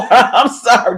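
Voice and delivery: normal talking voice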